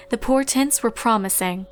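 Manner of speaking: ordinary delivery, nothing marked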